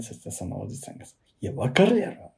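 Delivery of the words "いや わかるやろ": high, sharp retelling of an angry man; mock angry Kansai retort; irritated Kansai man. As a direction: mimic the annoyed customer snapping. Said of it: "めっちゃキレ出して".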